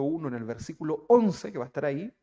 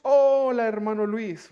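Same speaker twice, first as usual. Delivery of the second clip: loud, high, beaming hello; hearty greeting; 5 semitones higher, 6 dB louder, slower than usual. Acted. Preacher greets a newcomer at church with a loud hearty hello. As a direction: call out a big welcome across the room.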